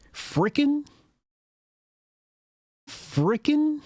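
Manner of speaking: very high-pitched baffled question; incredulous mocking. Streamer questioning the politician's word choice, in mock disbelief. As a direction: squeak the word back in disbelief.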